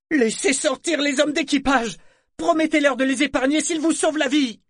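high, loud desperate roar; roared command. A narrator's reading. Boy to Saadar, demanding the crew be freed, roaring. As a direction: bellow it in a boy's high voice. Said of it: "rugit le jeune garçon à Saadar".

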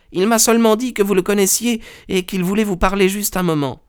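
high, quick maid's voice; a servant's high-pitched, hurried report. A narrator's reading. Maid reporting a visitor to her master, high quick voice. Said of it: "la bonne vint annoncer".

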